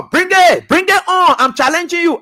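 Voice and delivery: loud and high, daring them; defiant challenge. Real, not acted. Speaker dares his political opponents, loud defiant challenge. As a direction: throw down the gauntlet, voice up.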